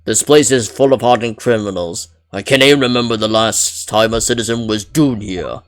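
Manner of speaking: loud, strained attempt at a Scottish burly man; Scottish accent, attempting a deep voice. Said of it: "hard to do an accent in the deep voice".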